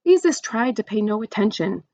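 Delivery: usual speaking voice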